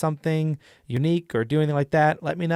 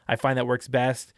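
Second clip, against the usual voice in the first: ordinary delivery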